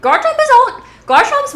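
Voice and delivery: high, loud burst; excited squeal. Real, not acted. Streamer gushing about her favorite Pokemon, high and excited. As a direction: gush about Garchomp.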